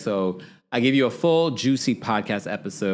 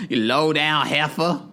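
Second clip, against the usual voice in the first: slow, higher drawn-out insult; Mama Joyce impression; 5 semitones higher, slower than usual. Imitating Mama Joyce insulting, slow and higher. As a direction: stretch heifer like an outraged old mother. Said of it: "my voice impression of it".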